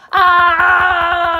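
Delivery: long loud ape roar; chest-beating yell. Acted. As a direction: let out a big chimp cry. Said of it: "Let's do that".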